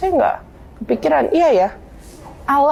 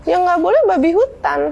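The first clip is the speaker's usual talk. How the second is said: higher-pitched defensive protest; retold self-defence, pitched up from the first word; defensive retort; 7 semitones higher than usual. Defending himself in a debate about pork, defensive. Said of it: "Saya membela diri".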